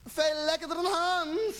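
high, drawn-out imagined cry of pleasure; imitating a woman crying out; mock screaming. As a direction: wail it slowly on a high pitch. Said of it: "En ze schreeuwt".

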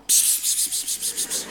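soft unvoiced pss-pss to call a cat. Shown as a demonstration. Comedian showing the audience how to call a cat, soft pss sounds. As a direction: lure a cat with quiet hissed pss sounds. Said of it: "En wel deze klank".

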